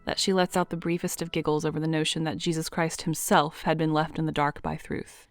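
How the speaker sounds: ordinary talk, nothing marked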